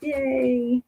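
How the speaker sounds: high little squeal of joy; a light, playful impression of her happy cheer; mock excited cheer. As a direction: imitate her delighted yay. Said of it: "She's like".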